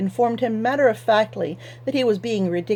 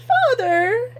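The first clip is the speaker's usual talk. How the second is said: much higher wailing cry of Father; wailing daughter; 4 dB louder than usual. Acted. Daughter wails at her father as the buyer leaves, much higher. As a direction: wail it high like a spoiled daughter. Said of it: "Anhelda wailed".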